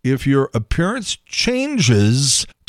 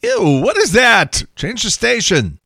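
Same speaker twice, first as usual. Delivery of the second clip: disgusted listener; 8 semitones higher, 3 dB louder than usual. Retold or imitated. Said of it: "people are like".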